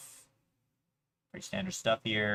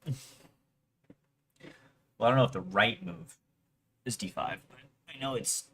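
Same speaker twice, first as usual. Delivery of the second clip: normal talking voice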